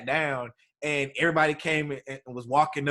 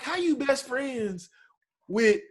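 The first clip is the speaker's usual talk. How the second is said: mock disbelief; 9 semitones higher than usual. Teasing his wife about Billy Sims, high and incredulous. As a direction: stretch it out in disbelief.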